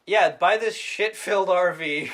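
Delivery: loud, drawn-out sales pitch; hammed-up salesman's sell; mock RV salesman. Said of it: "What a terrible R V salesman".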